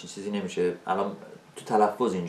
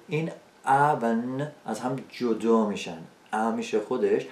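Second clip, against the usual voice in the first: ordinary talk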